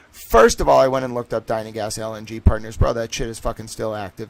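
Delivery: ordinary talk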